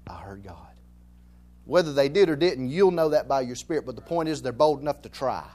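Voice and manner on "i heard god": hushed awed whisper; whispered reverent claim. Retold or imitated. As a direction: breathe it like a secret. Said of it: "they say".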